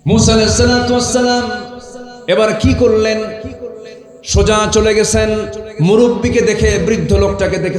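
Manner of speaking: high, loud sermon chant; full-force preaching. Acted. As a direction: preach it out high and ringing to a crowd.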